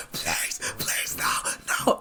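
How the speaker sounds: whispered, desperate begging; terrified pleading. Acted. Scientist begging the hero to spare him, whispered terror. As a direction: beg for your life under your breath. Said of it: "terrified and pleading for his life".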